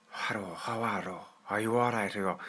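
put-on Irish greeting; hammed-up Irish hello; Irish accent. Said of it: "I did it in an Irish accent".

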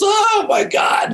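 high, slow drawn-out exasperation; exasperated groan. Real, not acted. Producer recalls the struggle to book guests, exasperated. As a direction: stretch it out, fed up.

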